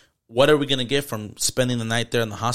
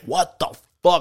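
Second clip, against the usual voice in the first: higher, louder alarmed exclaim; panicked what; 6 semitones higher, 4 dB louder than usual. Retold or imitated. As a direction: blurt it in sudden fright.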